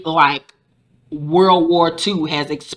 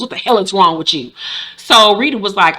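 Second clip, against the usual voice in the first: ordinary delivery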